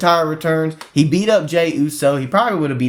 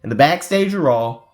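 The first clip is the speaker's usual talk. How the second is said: usual speaking voice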